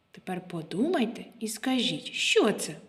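ordinary talk, nothing marked

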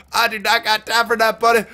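hammed-up sass; mock sassy. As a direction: toss it off like a sassy brush-off. Repeats line in a put-on voice.

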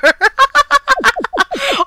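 long, high peal of laughter; real high giggling fit; laughing fit. Real, not acted. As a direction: laugh helplessly. Laughs after dying in game.

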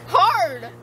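usual speaking voice

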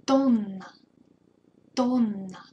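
Тонна with a held NN, TON-nna; teacher stretching the double N; long double N. Shown as a demonstration. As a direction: prolong the N in Тонна. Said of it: "Be sure to prolong N here".

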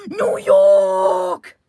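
gasp, then a loud low cry of New York; shocked gasp and cry. Acted. New York guy crying out after a shocking discovery.

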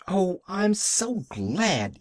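put-on high, drawn-out childlike delight; exult, stretching the words with joy; exultant. A narrator's reading. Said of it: "exulted Pollyanna".

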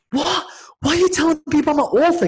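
high, startled what; shocked disbelief. Retold or imitated. Caught off guard by his intro, shocked question. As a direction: blurt it out in alarm. Shocked at being called author.

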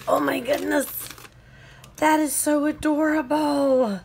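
high, loud delighted gush; excited squeal. Real, not acted. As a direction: squeal over something adorable. Squealing over cute items.

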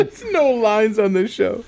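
high, drawn out through laughter; laughing. Real, not acted. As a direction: crack up while excusing the joke. Laughing at co-host's off-colour joke.

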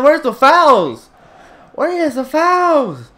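whining at the referee about fouls; whiny complaint. Real, not acted.

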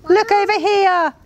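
high, eager call across the yard; piping, high-pitched shout. A narrator's reading. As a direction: call out high and excited to get attention. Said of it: "he heard Midge shout".

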